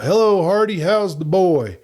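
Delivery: loud cheery hail, pitched high; calling out. A narrator's reading. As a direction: sing it out across the room. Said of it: "sung out".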